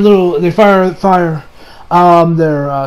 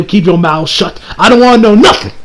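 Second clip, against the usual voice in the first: normal talking voice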